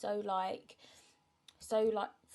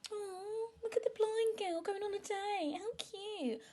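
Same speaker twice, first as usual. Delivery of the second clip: high, fake cooing voice mimicking strangers; mocking onlookers; 14 semitones higher, slower than usual. Mocking how strangers react to a blind woman on a date, in a high cooing voice. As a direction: coo it like a patronising stranger. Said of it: "They're gonna be looking like".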